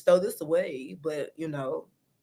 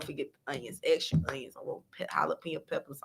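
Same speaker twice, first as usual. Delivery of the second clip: whisper; 5 semitones lower, 11 dB quieter, faster than usual. Acted. Whispered side order to the cook.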